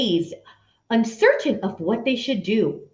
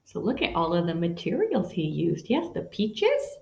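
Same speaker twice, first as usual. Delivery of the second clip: quiet aside; 7 semitones lower, 8 dB quieter than usual. Real, not acted. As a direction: point it out softly. Aside to listeners about picture.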